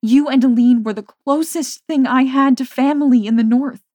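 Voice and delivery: pleading. A narrator's reading. Pleading with her friend.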